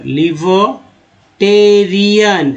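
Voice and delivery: लिबर्टेरियन slowly, with टे and रि stretched; syllable-by-syllable pronunciation. Shown as a demonstration. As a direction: break लिबर्टेरियन into parts, drawn out. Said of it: "अच्छे से पकड़िए".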